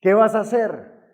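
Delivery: loud, high-pitched crowd's question; mock-accusing shout; confronting demand. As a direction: throw the question out like an impatient crowd. Crowd confronting him, acted out.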